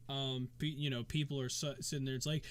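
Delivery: usual speaking voice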